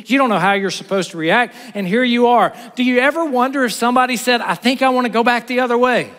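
high, loud, fast urgent sermon build; rising preaching. Acted. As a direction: drive the question home with urgency.

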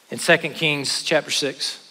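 ordinary delivery

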